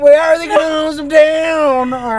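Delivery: high, loud outraged rant; indignant Pee-wee. Retold or imitated. Pee-wee ranting about how they bathe Francis, high outraged voice. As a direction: rant in disbelief at the idea.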